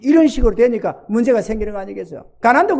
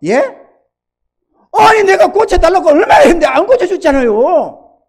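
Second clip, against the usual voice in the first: higher and louder, a hurt protest; a pastor answering God, upset and indignant; indignant retort; 9 semitones higher, 9 dB louder than usual. Retold or imitated. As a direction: talk back to God in a huff. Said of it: "화딱지가 나가지고 반박이 생기더래요".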